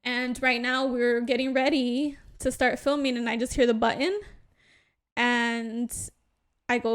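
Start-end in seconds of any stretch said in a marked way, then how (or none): none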